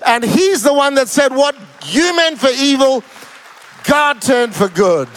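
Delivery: loud, high, driving pulpit delivery; booming preacher, slowing on the last line; full-force preaching. Acted. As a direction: preach it out to the whole room.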